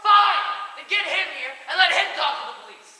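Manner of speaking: loud, high retort; angry shout. Acted. Snapping back at the friend who scolded, an angry shout. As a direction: fire it straight back.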